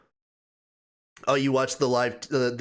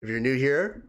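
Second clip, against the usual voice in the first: ordinary delivery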